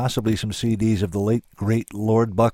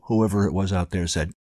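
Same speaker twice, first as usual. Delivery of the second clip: ordinary delivery, nothing marked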